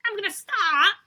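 high, loud teasing echo; mock announcer voice. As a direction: parrot her in a big announcer voice. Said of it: "echoes her announcement".

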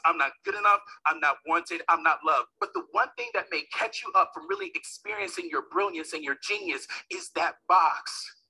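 ordinary talk, nothing marked

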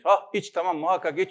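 hearty acted approval; cheerful urging. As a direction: urge it on with oblivious cheer. Deaf visitor cheerfully urges the medicine.

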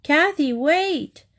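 slow, high call of Kathy, wait. A narrator's reading. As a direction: hail her from a distance. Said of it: "Kathy heard cries behind her".

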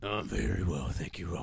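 very deep husky sore-throat voice. Real, not acted. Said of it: "That's as husky as it gets".

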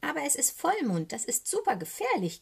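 high, urgent little raven voice; hurried caw. A narrator's reading. Little raven warns of the full moon, urgent caw. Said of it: "krächzt er rasch".

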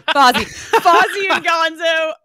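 loud, high excited naming. Real, not acted. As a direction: blurt the names with glee. Naming the bear.

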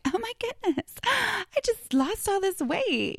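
mock delighted squeal. Younger self bragging about weight loss, in a hammed-up high squeal. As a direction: play her younger self gushing over easy weight loss.

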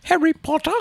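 high little house-elf voice; put-on squeaky elf; Dobby. Dad joining in on the elf impression, high elf voice. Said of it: "Dobby, yes".

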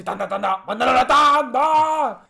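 loud, slow radio producer calling for ads; radio producer yell. Retold or imitated. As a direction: shout it like a radio producer cueing a break. Productor gritando como en radio. (productor de radio gritando que vayan a la tanda)

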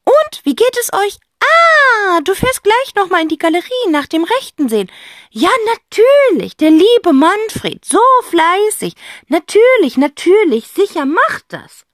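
very high, loud sugary phone talk; butter him up; fake-sweet aunt. A narrator's reading.

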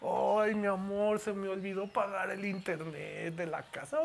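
sheepish newlywed admitting a slip; mock-sheepish husband confessing to his wife; little pleading voice. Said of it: "allá vas con vocecita así".

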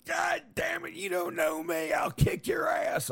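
raging talk-show guest, shouting; belligerent drunk. Acted. Maury show guest outburst.

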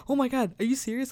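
fast, high, excited disbelief. Retold or imitated. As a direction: gush it out quickly, thrilled. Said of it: "I got so excited".

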